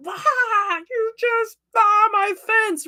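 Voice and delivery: high, squealing, slow imitated rage; hammed-up fence fury; mock angry outburst. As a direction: replay her own frantic shriek at the fence. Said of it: "I'm angry".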